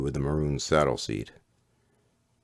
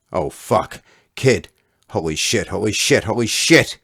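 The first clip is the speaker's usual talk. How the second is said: building, higher and louder panicked swearing; rising panic, each holy shit higher; panicked cursing; 7 semitones higher, 9 dB louder than usual. Acted. Fat kid horrified after crashing into the boy, panicked shouting.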